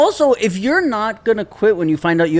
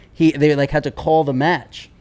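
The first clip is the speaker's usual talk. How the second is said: ordinary talk, nothing marked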